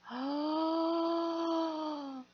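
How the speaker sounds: a long, high gasp of delight; sharp intake of breath, thrilled. Real, not acted. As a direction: gasp in wonder at the gift. Sees gift, delighted.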